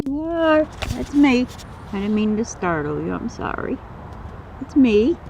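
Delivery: high, sing-song coo to a beloved snake. Real, not acted. Owner cooing to her big python, high sweet baby talk. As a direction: gush over him like a proud owner.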